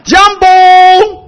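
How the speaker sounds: high, loud Swahili hello called out; shouted greeting. Shown as a demonstration. Preacher leading the congregation in a loud shout of greeting. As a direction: belt "Jambo" high and loud to the crowd. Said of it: "然后跟我一起喊".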